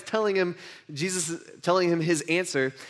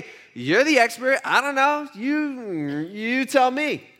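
higher-pitched, drawn-out tossing back of the question; challenging comeback; 7 semitones higher, slower than usual. Acted. Jesus bouncing the question back to the expert, playfully challenging. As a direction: act out putting the expert on the spot.

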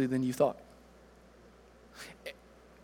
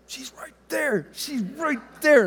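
put-on higher, hushed toddler tattling; imitating a little boy; 6 semitones higher than usual. Three-year-old son tattles on his hiding sister, higher mock-child voice. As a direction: play a three-year-old giving away a hiding place. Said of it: "he's like this".

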